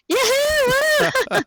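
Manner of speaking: high, loud yoo-hoo; enthusiastic. Acted. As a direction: call it out with big excitement. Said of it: "I can have some more enthusiasm there".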